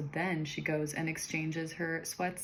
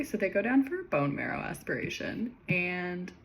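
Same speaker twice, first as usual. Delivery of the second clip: usual speaking voice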